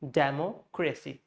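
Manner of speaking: Democracy as "DAM-o KRAY-see", in two chunks; Democracy broken after "demo", no reduced vowel; substandard pronunciation. Shown as a demonstration. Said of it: "So that's how we say".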